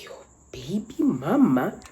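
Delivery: slow breathless whisper; shocked whisper. Retold or imitated. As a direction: whisper it, stunned.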